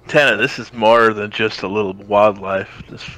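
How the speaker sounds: ordinary delivery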